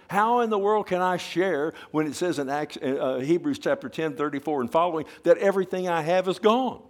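building preacher's cry. Real, not acted. Preacher to congregation, rising to a high loud cry. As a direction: build the outrage until the last words ring out.

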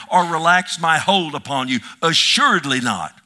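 loud, emphatic sermon reading, slowed on key phrases; preaching at full force. Acted. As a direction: pound every line of the promise home. Preacher reads scripture emphatically.

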